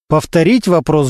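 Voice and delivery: usual speaking voice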